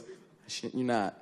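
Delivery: usual speaking voice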